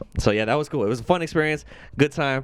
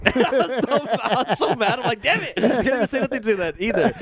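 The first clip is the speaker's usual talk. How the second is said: laughing; 12 semitones higher than usual. Real, not acted. Laughs retelling the loss.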